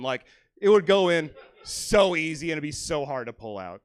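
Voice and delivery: normal talking voice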